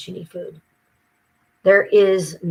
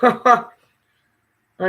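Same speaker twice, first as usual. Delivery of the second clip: laughing; 4 semitones higher, 7 dB louder than usual. Real, not acted.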